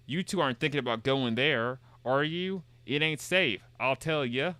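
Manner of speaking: a folksy Southern local warning of danger; Southern accent. Acted. Streamer voicing a Southern villager's warning, lightly Southern. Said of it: "more Southerners speak".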